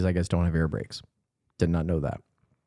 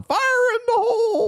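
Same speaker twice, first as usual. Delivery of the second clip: mock warning shout. Podcaster acting out the movie's pour, mock high yell. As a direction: holler it way up high, stretched out.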